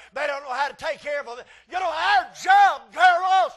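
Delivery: loud, high, rising preacher's cry; preaching at full force. Acted. Preacher scolds the congregation about girls, building to a shout.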